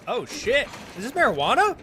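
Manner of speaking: thrilled, high-pitched discovery; excited. Real, not acted. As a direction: shout it out, delighted. Finds weed in game.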